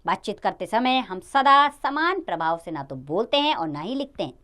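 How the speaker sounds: ordinary talk, nothing marked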